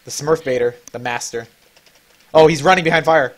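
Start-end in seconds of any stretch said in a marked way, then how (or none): 2.3-3.3 urgent callout. Real, not acted.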